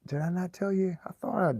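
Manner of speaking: incredulous, as if he surely said it already; surprised. Real, not acted.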